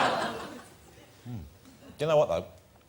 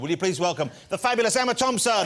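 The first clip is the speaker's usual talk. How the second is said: guest introduction; 5 semitones higher than usual. Acted.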